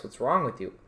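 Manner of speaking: ordinary talk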